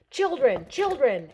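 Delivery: loud falling repeated calls; mock scolding shout. Scolding the lady about the kids, loud retold call. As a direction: hammer the word home twice, loud and falling.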